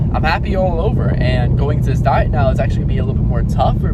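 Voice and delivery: usual speaking voice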